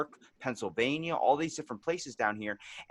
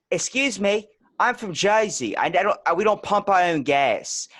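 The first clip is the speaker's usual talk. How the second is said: loud, high mock New Jersey accent; indignant Jersey woman, accent laid on thick; strong Jersey accent; 7 semitones higher, 6 dB louder than usual. Jersey woman objecting at the gas pump, strong accent. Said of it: "hit me strong with that Jersey accent".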